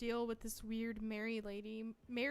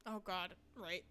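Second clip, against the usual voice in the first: normal talking voice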